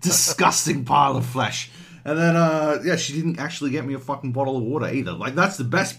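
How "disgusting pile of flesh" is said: evil queen. Pretend. Mock outrage at the flight attendant.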